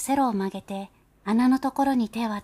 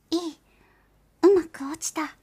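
put-on tiny child voice; 8 semitones higher, 5 dB louder than usual. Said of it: "蚊のような小さな声で".

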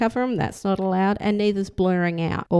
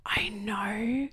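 breathy whisper of a sudden idea; excited whisper; 5 dB quieter than usual. Real, not acted. Mum whispering a sudden idea to her son. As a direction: hush it like a secret plan.

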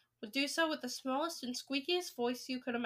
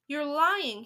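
loud, outraged accusation; raised-voice denial; crying out; 3 semitones higher, 8 dB louder than usual. A narrator's reading. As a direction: cry it out in disbelief. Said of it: "he cried".

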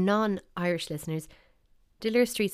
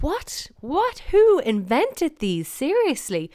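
high, loud incredulous outburst; exasperated disbelief; 8 semitones higher, 6 dB louder, slower than usual. Real, not acted. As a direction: demand it in baffled outrage. Mock outrage at odd names.

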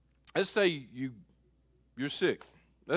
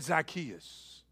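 soft, falling, calling a name gently; tender call; 3 semitones lower, 7 dB quieter than usual. Retold or imitated. Jesus calling Zacchaeus by name, soft and tender. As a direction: say his name softly, like a friend. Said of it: "He said".

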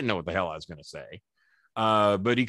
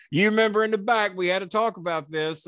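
high, raised-voice promo confrontation; wrestling promo; 9 semitones higher, 4 dB louder than usual. Retold or imitated. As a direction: call him out before the crowd. Retold on-camera confrontation with Jimmy.

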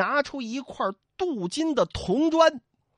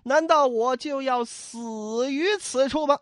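theatrical despairing sigh, higher pitched; long lament; 4 semitones higher than usual. A narrator's reading. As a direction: lament to the heavens, drawing it out. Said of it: "宋江仰天长叹".

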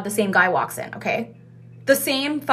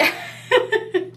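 breathy high giggle; high airy laugh; 12 semitones higher than usual. Real, not acted. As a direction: laugh it off lightly. Laughing at the mix-up.

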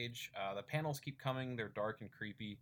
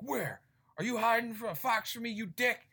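much higher, louder angry yell; pissed-off shout; 12 semitones higher, 7 dB louder than usual. Retold or imitated. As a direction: snap at him in sudden rage. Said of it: "instantly pissed".

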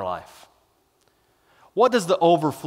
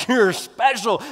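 ordinary talk

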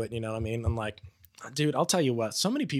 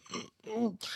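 high, soft "mm, mm"; nervous closed-mouth hum; 10 semitones higher, 7 dB quieter than usual. Retold or imitated. Sweating sponsee to sponsor, nervous stifled humming. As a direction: hum a squirming refusal to speak.

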